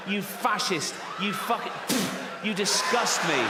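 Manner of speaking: high, outraged mock rant; hammed-up righteous fury; disgusted tirade. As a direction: spit insults at a small bigot. Acted outraged tirade at Timmy.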